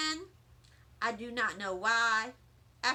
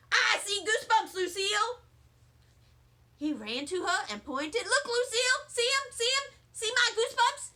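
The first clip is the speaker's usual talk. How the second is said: excited; 9 semitones higher, 4 dB louder than usual. Acted. Sheldon excitedly showing Lucille.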